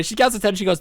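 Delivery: ordinary delivery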